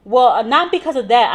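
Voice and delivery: loud, defensive "not". Retold or imitated. Patient defending herself to the nurse, defensive. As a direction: push back hard. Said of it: "she was like".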